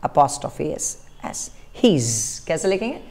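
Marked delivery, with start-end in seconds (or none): 1.8-2.4 "his" said low and soft as the contraction of he is; teacher models the he's contraction; contracted he's. Shown as a demonstration. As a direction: say he is as one quick "his". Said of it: "बोलेंगे कैसे?".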